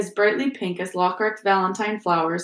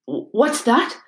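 stammered, a touch higher in shock; stunned; 4 semitones higher than usual. Acted. Mr Weasley stunned by Dumbledore's news, stunned stammer. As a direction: caught off guard, stumbling. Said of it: "in a stunned voice".

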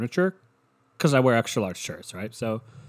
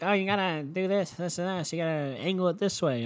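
higher-pitched mock instructions; mimicking fussy helpers; 7 semitones higher than usual. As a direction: imitate fussy helpers in a high voice. Mimicking movers giving fussy directions.

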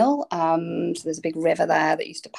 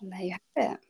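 soft whisper; 6 dB quieter, much faster than usual. Real, not acted.

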